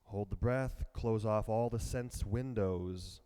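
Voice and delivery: ordinary talk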